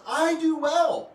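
loud, high, drawn-out retort; defiant back talk. Retold or imitated. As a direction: talk back defiantly, stretching each word. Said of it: "you can't talk to God that way".

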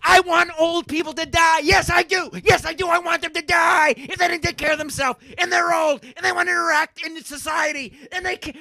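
high, loud ranting shout; angry shouting. Real, not acted. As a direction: hammer each want out in anger. Escalating rant about old people.

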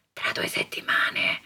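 unvoiced whispered reply. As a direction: murmur the answer to yourself. Whispered answer to herself. (sussurrato tra sé)

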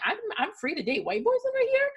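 high, fast gleeful rush; giddy mock glee. Girl newly in the US, giddy and high. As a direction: gush it like someone just set free. Her own freed thought retold.

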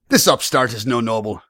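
loud, high, threatening demand; blustering barman making demands; defiant tavern owner. Acted. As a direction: throw the threat back loudly.

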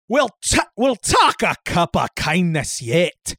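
put-on slow, broad Scottish accent; drawn-out Scottish brogue, played for laughs; Fat Bastard impression. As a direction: do a hammy Scottish movie-character voice. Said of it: "You got your fat bastard impression going".

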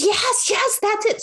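light up like you finally found it; sister's eureka, voiced high; excited recognition.

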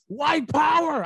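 high loud yell from the back; yelled slogan. Retold or imitated. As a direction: shout it across the room. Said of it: "somebody in the background yell".

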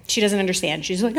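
usual speaking voice

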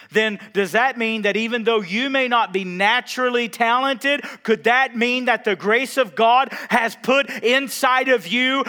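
high, loud, insistent sermon questions; full-force preaching. Real, not acted. Preacher asking the church what God put inside them, full-force preaching. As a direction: drive each question home at full voice.

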